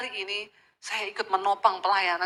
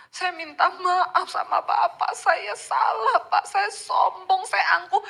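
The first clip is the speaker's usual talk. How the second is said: high, slow, choked-up pleading; tearful apology; 8 semitones higher, slower than usual. Retold or imitated. Preacher reliving her tearful apology to the pastor, high and slow. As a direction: beg forgiveness through tears. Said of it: "Saya dengan berlinang air mata".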